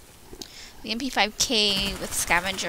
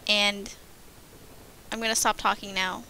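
normal talking voice

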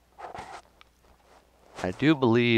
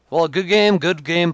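loud good game; 7 semitones higher, 8 dB louder than usual. Real, not acted. Ending the match.

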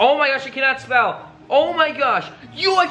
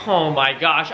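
ordinary delivery

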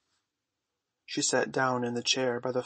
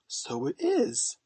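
high, slow, half-hearted agreement; unconvinced; 11 semitones higher, slower than usual. A narrator's reading. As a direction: agree slowly in a high little-boy voice, without conviction. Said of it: "but not with much conviction".

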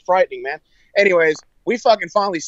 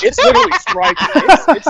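high, loud nervous laugh through the words; scared laugh; 13 semitones higher, 6 dB louder than usual. Real, not acted. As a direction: laugh in fright as it strikes close. Lightning striking very close.

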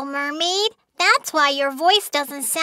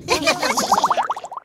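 high giggle. Acted. Cheerful laugh after song.

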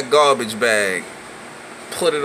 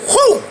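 high loud whoo of effort; swing whoosh; 5 dB louder than usual. Retold or imitated. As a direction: let out a punchy whoo. Swinging the punch.

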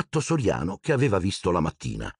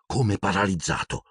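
plain reading voice

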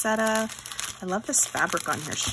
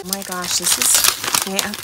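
usual speaking voice

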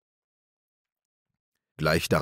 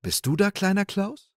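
much higher, sweet maiden's voice; sea maiden; 8 semitones higher than usual. Acted. Maiden under the water welcoming little Klaus, sweetly. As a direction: greet him like a gracious sea maiden. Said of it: "die schönste Jungfrau ... und sagte".

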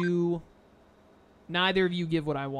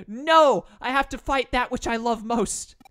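higher, louder mock-tragic cry; mock anime despair; hammed-up anguish; 5 semitones higher, 6 dB louder, slower than usual. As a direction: ham it up like a tortured hero. Mock dramatic anime line.

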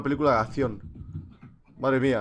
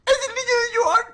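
very high, louder squealing cry; mock shriek; 5 dB louder than usual. Streamer shrieking at a stranger's face on camera, mock panic squeal. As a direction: shriek it like a scared child.

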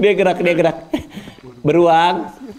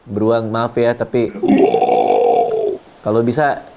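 low soothing voice; 9 semitones lower than usual. Real, not acted. Speaking low and gently to bear.

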